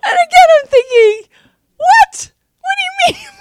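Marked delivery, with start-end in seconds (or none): 1.8-3.3 high, loud laughing what; incredulous what through laughter; laughing disbelief. Retold or imitated. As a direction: squeal the question out through laughter. Retold disbelief, laughing hard.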